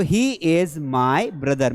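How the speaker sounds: he wants money said very slowly, word by word; slow dictation. Shown as a demonstration. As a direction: spell it out slowly for the class. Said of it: "मेरे साथ बोलते भी जाइए". (धीरे-धीरे बोलकर सिखाना)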